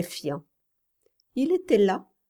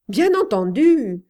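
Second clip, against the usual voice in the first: louder, forced agreement; loud hasty agreement; cry out; 3 semitones higher, 7 dB louder than usual. A narrator's reading. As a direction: call it out to cover doubt. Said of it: "cria Jimmy".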